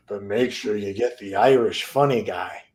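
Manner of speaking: normal talking voice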